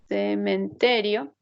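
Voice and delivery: Cementerio drawn out slowly, each syllable clear; slow spelled-out word. Shown as a demonstration. Teacher to class, slowly showing the word Cementerio. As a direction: lay out Cementerio slowly so students hear its parts. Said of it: "No es que solamente se saca la O".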